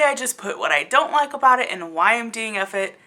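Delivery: ordinary delivery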